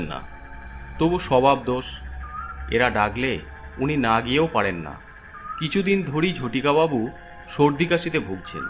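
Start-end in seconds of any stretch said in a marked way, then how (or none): none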